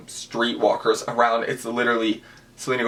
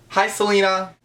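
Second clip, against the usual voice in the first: high, bright call of her name, louder; excited greeting; 9 semitones higher, 3 dB louder than usual. Retold or imitated. Fan calling out to [name] across the parking lot, excited high greeting. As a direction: call out to a star you just spotted.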